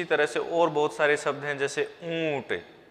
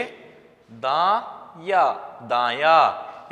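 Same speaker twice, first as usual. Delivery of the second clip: दाया drawn out very slowly; slow demo; 4 dB louder, much slower than usual. Shown as a demonstration. Teacher joins the syllables slowly for students. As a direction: stretch या and दाया. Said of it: "जब हम comment करके बोलेंगे तो क्या बोलेंगे?".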